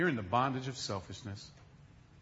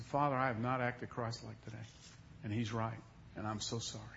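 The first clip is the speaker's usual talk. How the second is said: much softer, lower confession; contrite prayer; 5 semitones lower, 9 dB quieter than usual. Retold or imitated. Father apologizing to God before his son, soft and low. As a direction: confess softly.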